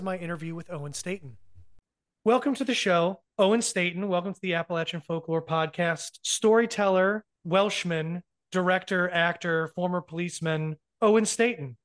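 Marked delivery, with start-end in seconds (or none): 2.3-11.8 loud announcer build-up; hyped guest introduction. Acted. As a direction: present the guest like a showman. Host's big guest introduction.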